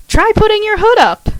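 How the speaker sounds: high, loud, eager call; excited shout about the costume. Acted. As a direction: call it out brightly to her roommate. Karen calls to roommate.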